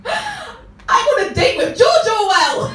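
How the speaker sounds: high, loud, drawn-out squeal; hammed-up shriek of starstruck joy; ecstatic fangirl shriek. Woman thrilled her date is George Orwell, ecstatic shriek. Acting first thought, thrilled fan outburst.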